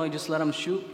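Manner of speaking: normal talking voice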